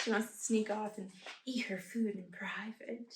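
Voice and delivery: hushed, playful aside; soft, secretive murmur; confiding whisper. Real, not acted. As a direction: whisper the pet's little secret. Confides pet's secret in play.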